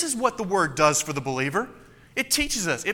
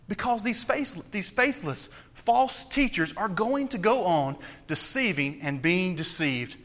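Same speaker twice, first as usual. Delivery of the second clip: ordinary talk, nothing marked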